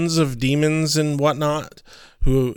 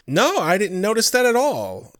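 higher-pitched mock denial; retold character reply; 8 semitones higher than usual. Podcaster quoting Wesley to co-host, mock denial. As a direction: toss it out as a mimicked character quote. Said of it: "And Wesley's like".

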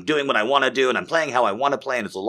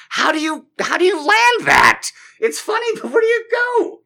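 very high, strained comic voice; exasperated self-quote; 4 dB louder than usual. Retold or imitated. Comic stuck on how to end his bit, high exasperated squeal. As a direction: squeal the questions in mock despair. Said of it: "I was like".

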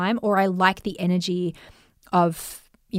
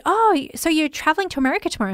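higher, quick chatty salon lady; 7 semitones higher, faster than usual. Retold or imitated. Salon lady asks client about travel, high chatty imitation. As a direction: imitate a hairdresser making quick, high small talk. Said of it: "And the lady said".

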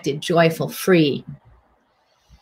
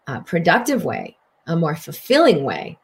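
ordinary delivery

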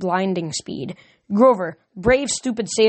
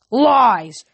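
higher, loud furious cry; angry shout; 5 semitones higher, 5 dB louder than usual. A narrator's reading. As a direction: hurl the word in rage. Kronos denies furiously.

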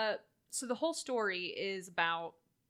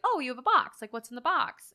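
put-on curious kid; 3 semitones higher, 8 dB louder than usual. Kid voiced in retelling.

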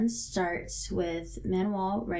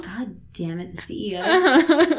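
drawn-out, high, loud mock outburst; hammed-up cursing, rising sharply; mock exasperation; 6 semitones higher, 9 dB louder than usual. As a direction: build to a high, loud wail on CEO. Mock exasperation at the plot twist.

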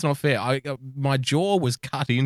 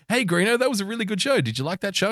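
eager puppy; 8 semitones higher than usual. Retold or imitated. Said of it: "So I'll dance around him and I'm like".

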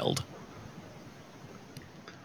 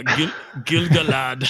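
Gilgalad in a hammed-up German accent, higher and louder; 4 semitones higher, 4 dB louder, slower than usual. Shown as a demonstration. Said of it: "how you'd say Gilgalad in a German accent".

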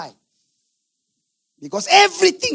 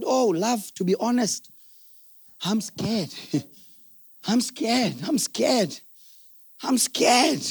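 quiet confession; 7 dB quieter than usual. Retold or imitated. Husband admitting to his wife he is scared, soft. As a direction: say it softly, like owning up to your wife.

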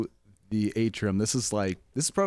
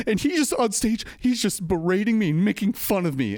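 imitated upset complaint, much higher; distraught woman; 8 semitones higher than usual. Offended audience member about the comic, mock distraught. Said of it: "lady who's just distraught".

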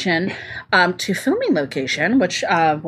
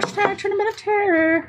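spooky hype; 12 semitones higher than usual. Real, not acted. Hyping the spooky title.

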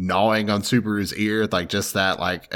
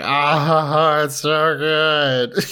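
overwhelmed; 7 semitones higher, 4 dB louder, much slower than usual. Real, not acted. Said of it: "Like, I'm crying".

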